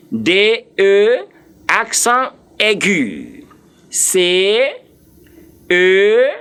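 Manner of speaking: D E C M B R E spelt as day, uh, say, em, bay, air, uh; French letter names. Shown as a demonstration. Teacher spells as a Frenchman will, D E C E M B R E in French letter names. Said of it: "the way the Frenchman will spell it".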